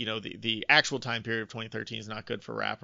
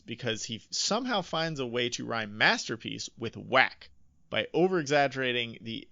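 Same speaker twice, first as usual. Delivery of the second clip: ordinary talk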